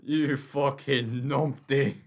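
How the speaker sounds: slow, loud Scots insult; trading Scottish insults, mock Scots. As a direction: drag out numpty in a mock Scottish voice. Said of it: "As in, don't be a wee clape".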